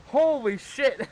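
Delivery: shaken laughter. Real, not acted. Laughing after the scare.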